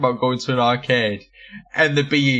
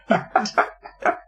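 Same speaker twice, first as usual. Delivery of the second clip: laughing; 8 semitones higher than usual. Real, not acted. Laughing after shouting the name.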